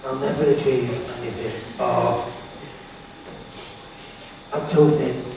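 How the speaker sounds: low, quiet self-doubting mutter; a hint of the negative voice in your head; inner critic voice. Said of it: "them two little voices".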